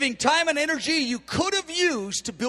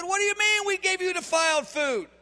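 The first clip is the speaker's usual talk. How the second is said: higher, whiny, indignant leaders; offended protest; 8 semitones higher than usual. Retold or imitated. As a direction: pitch it up in mock disbelief.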